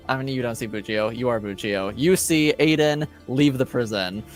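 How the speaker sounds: normal talking voice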